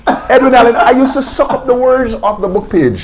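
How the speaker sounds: high, laughing reply; real laughter running through the words. Real, not acted. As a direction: answer while laughing, pitch way up. Laughing answer to friend's question.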